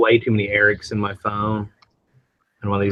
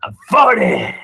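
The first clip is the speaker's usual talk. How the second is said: mock echo; 10 semitones higher, 7 dB louder, slower than usual. Echoes her mockingly.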